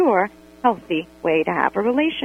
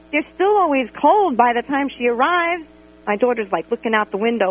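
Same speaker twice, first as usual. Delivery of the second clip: ordinary talk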